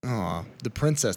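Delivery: ordinary talk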